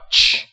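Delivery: "ch" as a voiceless burst, as ending "watch"; ch sound. Shown as a demonstration. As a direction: sound out "ch" alone, without voice. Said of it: "它是发".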